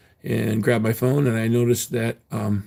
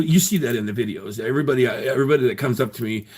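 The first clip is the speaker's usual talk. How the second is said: usual speaking voice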